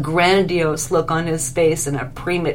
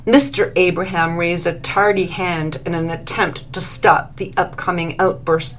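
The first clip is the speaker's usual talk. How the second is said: plain reading voice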